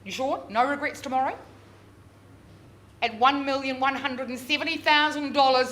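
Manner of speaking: ordinary delivery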